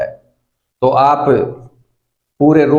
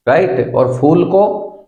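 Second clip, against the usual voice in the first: usual speaking voice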